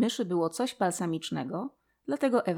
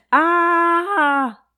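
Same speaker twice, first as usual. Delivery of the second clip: much higher and louder cry of shock; startled shout; 9 semitones higher, 9 dB louder, much slower than usual. A narrator's reading. Ewa waking before the great mouse, shouting in shock. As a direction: jolt awake and shout the question. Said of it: "krzyknęła Ewa".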